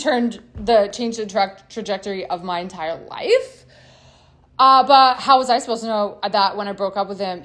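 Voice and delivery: ordinary talk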